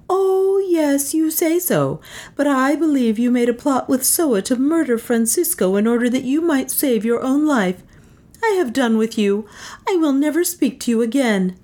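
high, bitter accusing woman; scornful, wounded woman's voice; furious heroine. A narrator's reading. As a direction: throw accusations, pitched high.

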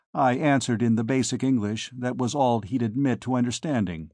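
ordinary delivery

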